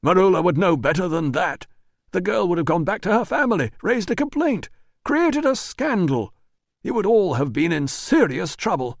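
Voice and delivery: high, fast, defensive denial; nervous manager. Acted. Manager denies the letter to Russo, high and rushed. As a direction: talk fast to dodge the blame. Priscus voice held.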